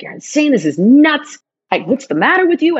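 high, heated exasperation; retold outrage. To partner during first fight, heated and exasperated.

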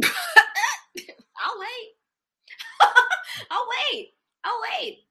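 high-pitched, through real laughter; laughing challenge. Real, not acted. Host daring listeners to name a buyer, laughing challenge. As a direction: crack up while throwing down the challenge. Cracks up at her own jibe.

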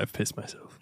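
whispered confession. Pretend. Joking to co-hosts, a hushed whispered confession. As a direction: breathe it out as a secret.

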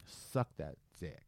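lightly voiced stranger, low and soft; retold stranger. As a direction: imitate him low, slow, under the breath. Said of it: "I hear him go".

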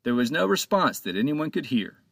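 ordinary delivery

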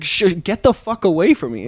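half-hearted imitation of screaming someone away; retold scream. Friend screaming at the shot seller, mild mock yell. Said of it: "And he screamed".

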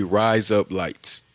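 "Rise up lights" said very slowly, each word drawn out; slow mock Aussie. Shown as a demonstration. Telling parents what kids should say, slowly. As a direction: draw out "Rise up lights" like razor blades. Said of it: "have them say".

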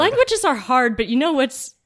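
bright emphatic echo; emphatic agreement. Real, not acted. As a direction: pounce on it and agree loudly.

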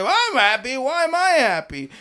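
high incredulous yelling; mock yell back. Husband yelling at his wife mid-argument. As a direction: fling it back at her, exasperated. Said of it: "I'm like".

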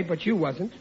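normal talking voice

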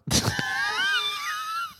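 long high wheezy laugh; helpless high-pitched laughter. Real, not acted. Laughing at the review.

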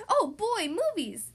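high, loud delighted exclaim; excited cubs. Acted. Cubs spotting the projector, excited high cheer. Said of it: "said the cubs".

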